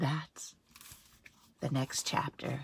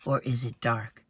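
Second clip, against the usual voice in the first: ordinary delivery, nothing marked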